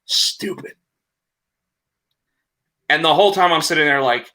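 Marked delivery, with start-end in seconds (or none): 0.0-0.7 sneaky whisper. Retold or imitated. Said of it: "and he's like".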